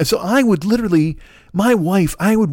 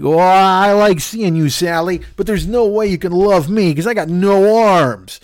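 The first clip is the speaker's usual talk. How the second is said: mock pitiful moan, stretched out slowly; self-pitying; 3 semitones higher, 3 dB louder, slower than usual. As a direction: wallow in hammed-up self-pity. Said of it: "bullshit pity party for no arms".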